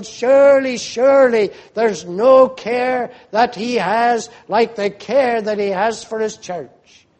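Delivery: earnest cry fading at the end; pleading cry. Acted. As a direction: beg with conviction. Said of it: "church is crying out to God".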